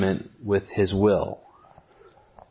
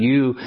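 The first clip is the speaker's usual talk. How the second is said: "you" punched clearly louder and a bit higher; emphatic you; 3 semitones higher, 6 dB louder than usual. Shown as a demonstration. Explaining the Greek word order to listeners, emphatic. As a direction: hit "you" hard, it is the point. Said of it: "there is in the emphatic position".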